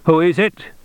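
put-on high, no-nonsense German accent; Germanic voice. A narrator's reading. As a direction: answer the phone as a curt German official. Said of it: "a Germanic voice said".

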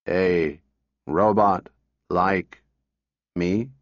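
low, very slow, toneless machine voice; flat robot voice. A narrator's reading. Robot answering a little girl, flat and mechanical. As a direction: drone it out like a talking machine.

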